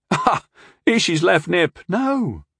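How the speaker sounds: high scoffing laugh. A narrator's reading. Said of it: "Tyndall laughed at the prospect".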